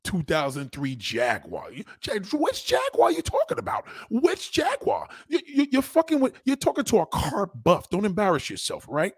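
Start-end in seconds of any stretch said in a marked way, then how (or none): 2.4-6.9 much higher, squeaky disbelief; incredulous. Real, not acted. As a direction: pitch it way up in disbelief. Mocking disbelief at commenter.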